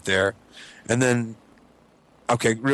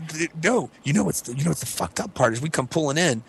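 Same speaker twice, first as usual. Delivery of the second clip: usual speaking voice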